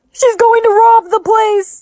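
high, loud, drawn-out cry; alarmed shout. Acted. Bystander warning of a thug robbing the place, alarmed. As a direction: raise the alarm in panic.

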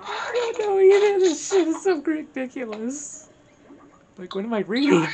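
loud giggly "what am I reading"; laughing disbelief. Real, not acted. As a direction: crack up at the ridiculous script. Reader bursts out at absurd script.

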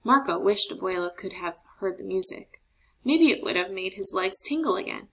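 usual speaking voice

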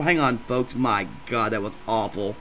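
ordinary delivery

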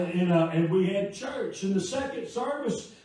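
loud, impassioned pulpit delivery; full-force preaching. Real, not acted. Preacher testifies to the congregation how God provides, loud fervent preaching. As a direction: preach it out loud, driving toward each amen.

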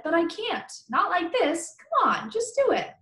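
pleading. Acted. Friend pleading to be hammered.

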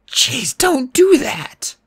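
high, drawn-out breathy protest; startled. A narrator's reading. Boy startled by invisible friend.

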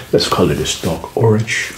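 usual speaking voice

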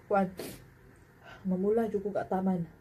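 chewing while talking; speaking around food; mouth full. Real, not acted. Seen 1: hand-feeds rice into mouth, then chews with cheeks full.

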